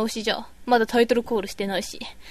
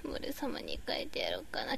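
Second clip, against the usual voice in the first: whispered repeat to herself; 13 dB quieter than usual. Real, not acted. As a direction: breathe the same line, no voice. Whispered repeat of own line.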